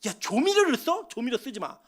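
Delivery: acted-out exasperated telling-off; riled-up scolding. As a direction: scold the young cooks as if fed up. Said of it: "그럼 막 열받죠".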